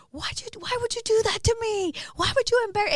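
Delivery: high-pitched, upset reproach; retold wail of betrayal; hurt pleading. Retold tearful confrontation with co-host.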